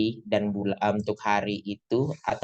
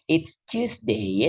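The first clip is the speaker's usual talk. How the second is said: put-on slow, drawn-out British accent, pitched higher; British Harry Potter accent; 6 semitones higher, much slower than usual. Teacher to class, imitating a British accent slowly. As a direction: play a Harry Potter-style Brit, stretching each word. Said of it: "yang kayak gitu bicaranya".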